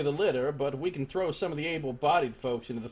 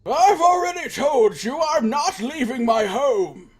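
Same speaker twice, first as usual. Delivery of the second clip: put-on high, slow, loud creaky old man yelling; cranky old man; 11 semitones higher, 10 dB louder, slower than usual. Gamer voicing Old Man Mua, shouting from his doorway. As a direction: bellow like a stubborn old hermit. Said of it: "let's talk to Old Man Mua".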